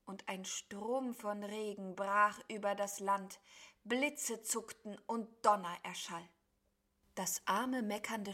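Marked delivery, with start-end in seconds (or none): none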